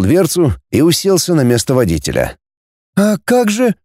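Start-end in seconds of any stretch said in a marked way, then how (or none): 3.0-3.7 higher, feeble objection; weakly. A narrator's reading. Girl balks at taking the car, weakly. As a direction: let the question out faintly, unsure. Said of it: "слабо проговорила Наташа".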